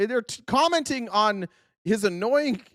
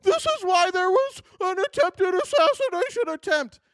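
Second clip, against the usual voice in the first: much higher, whining mockery of a Republican; hammed-up crybaby complaint; whiny mock voice; 12 semitones higher than usual. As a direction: whine it like a crybaby. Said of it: "and you're like".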